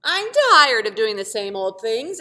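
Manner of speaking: loud complaining grumble; grumbling. Acted. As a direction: whine like you're fed up. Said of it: "it grumbled".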